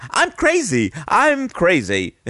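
high, drawn-out mock English accent; put-on English accent. Imitating the coworker at work, high mock English accent. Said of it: "I'll use an English accent".

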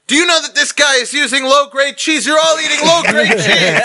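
high, loud outraged shout. Retold or imitated. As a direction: bellow it to the whole pizzeria. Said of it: "I say very loud".

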